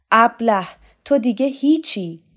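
loud, high contemptuous shout; angry insult. Acted. Rakhov shouting an insult at Shokat, angry. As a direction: spit the insult at him.

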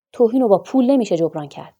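loud, offended rebuke; indignant retort. A narrator's reading. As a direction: throw the money back with wounded pride. Doctor rejects the money angrily. (پاسخ بلند و آزرده از توهین)

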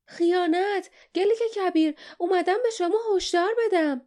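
high, urgent yell; shouted warning from an excited informer; alarmed shout. A narrator's reading. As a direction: cry treason at a high pitch. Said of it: "فریاد کشید".

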